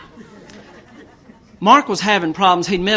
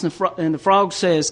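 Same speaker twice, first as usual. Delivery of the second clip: ordinary delivery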